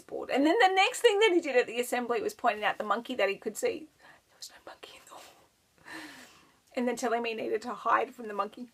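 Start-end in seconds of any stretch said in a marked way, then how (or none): 4.0-5.2 soft unvoiced whisper. Real, not acted. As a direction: whisper it like a secret. Retelling the assembly in a whisper.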